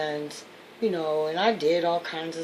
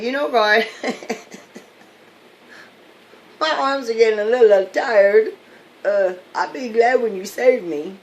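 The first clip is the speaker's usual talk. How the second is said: louder, higher, half-laughing plea; weary pleading believer; 6 semitones higher, 11 dB louder than usual. Acted. Drowning man gently nudging God, half-laughing plea. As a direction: tell God your arms are tired, still hopeful.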